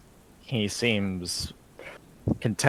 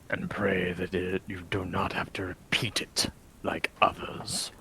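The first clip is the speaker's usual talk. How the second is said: brooding vampire lord; 6 dB quieter than usual. Acted. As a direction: speak as a weary, cursed lord in a hushed voice. Vladimir threatens the party.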